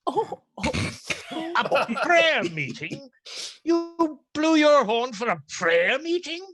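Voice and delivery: can't believe what he's hearing; incredulous outrage. Acted. Friar outraged at prayer meeting excuse.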